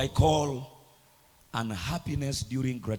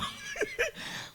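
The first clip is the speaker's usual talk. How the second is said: high giggle; 7 dB quieter than usual. Retold or imitated. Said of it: "mock grateful reaction".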